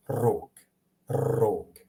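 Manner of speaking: "rog" opened with a long trill; rolled R. Shown as a demonstration. Teacher contrasting it with horn, trilled R in "rog". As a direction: roll the R at the start of "rog". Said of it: "In, in Croatian".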